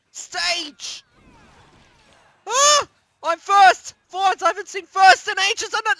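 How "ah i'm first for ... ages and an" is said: high loud thrilled shouting; excited yell. Real, not acted. Gamer surprised to be first, excited shout. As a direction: cheer at the win.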